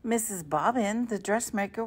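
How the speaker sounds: usual speaking voice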